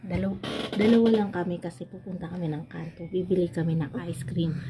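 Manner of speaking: soft hushed telling; whispering while hiding. Real, not acted. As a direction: keep the secret quiet. Hushed secret plan. (pabulong na kuwento ng lihim)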